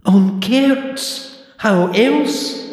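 put-on high, prim old librarian; clipped, fussy old lady librarian. A narrator's reading. Deirdre voiced.

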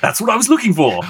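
high, quick put-on British accent; excited mock British approval; British director impression. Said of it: "you keep doing a British accent for David Yates".

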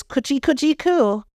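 put-on high cooing baby talk. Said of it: "as they say in the old days".

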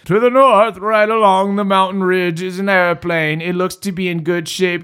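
a hint of a kindly old man. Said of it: "voice of a friendly old man".

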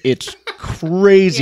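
drawn out, high, laughing; amazed laugh. Real, not acted. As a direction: stretch it slowly through a laugh. Laughing about her voice.

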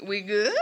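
"We good?" with a rising, tentative end; questioning. Acted. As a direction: lift "good" into an unsure question. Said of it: "Question mark?".

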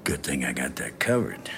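hushed. Real, not acted. Quiet private reassurance.

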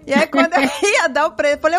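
ordinary talk

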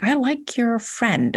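put-on mild child voice, confessing a crush; shy little kid. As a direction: ease it out like a shy eight-year-old. Child self confessing to sister.

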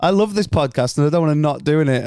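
ordinary talk, nothing marked

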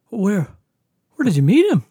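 imitating a suspicious mother, pitched high; high-voiced parental interrogation, retold; questioning mom. Mom questions who the stranger is.

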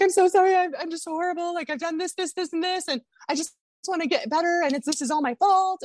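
high, tearful rush of apology; retold blubbering. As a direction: pitch it up like a blubbering hot mess. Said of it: "I was just blubbering".

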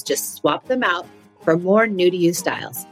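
usual speaking voice

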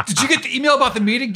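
quick, high mock old lady, laughing; older woman's voice. Older writer quizzing him fast, high and laughing. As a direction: rattle it off high and amused.

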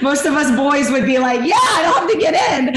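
high, loud, laughing mock shout of the boys; mock macho shout. Teasing the boys' bravado, hammed-up high shout. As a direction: ham up the tough guys shouting with a laugh. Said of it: "he's like".

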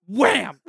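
loud "wham" sound effect; imitated bang. Storyteller imitates a receiver being slammed, loud bang. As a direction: shout the bang as a sudden impact.

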